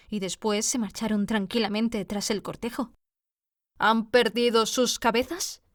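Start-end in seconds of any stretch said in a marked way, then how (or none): none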